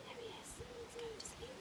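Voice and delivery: high, soft whisper. Acted. Storyteller whispering to children as the giant sleeps.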